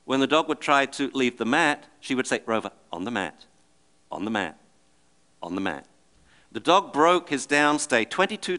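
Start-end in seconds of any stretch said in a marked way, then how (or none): 2.5-5.9 softer, steady, patient repeating; calm insistence. Retold or imitated. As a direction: hold the dog in place calmly without raising your voice. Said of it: "by the owner's calm insistence".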